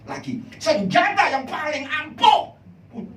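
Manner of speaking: high, loud preacher's cry; loud, high-pitched sermon climax; fiery preaching. Acted. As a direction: thunder it at the congregation. Preaching at full force.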